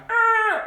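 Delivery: very high, loud scream; repeated scream. A narrator's reading. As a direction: shriek it out to hammer the point. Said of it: "And then I write".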